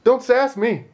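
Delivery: high, loud hammed-up scolding; wife told off in a high, loud mock scold; mock stern husband. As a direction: ham up a bossy threat.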